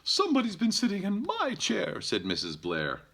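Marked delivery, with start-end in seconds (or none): 0.0-2.0 much higher, louder mother bear voice. Acted. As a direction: squeak it out like a fussy mother bear. Missus Blair voiced in story.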